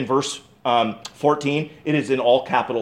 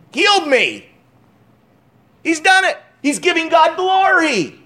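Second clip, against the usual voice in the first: exultant; 13 semitones higher, 3 dB louder than usual. Acted. Preacher exulting over healing.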